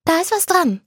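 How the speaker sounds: put-on high, girlish agreement; a bright yes from the friend; Anna agreeing. Anna agreeing with Kane, high and bright.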